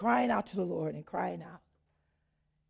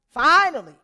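"finally" shouted, higher and louder; loud emphatic finally; 5 semitones higher, 13 dB louder than usual. Real, not acted. Telling the church of the long wait, loud relief.

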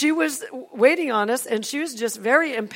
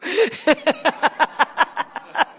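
long, high peal of laughter; real delighted laughter; hearty laugh; 6 semitones higher, 4 dB louder than usual. Real, not acted. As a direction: burst out laughing in a rapid run. Laughs at own retold line.

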